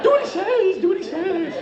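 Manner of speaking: high-pitched frightened hostage; mock panicked plea. Panicked hostage in hijack bit.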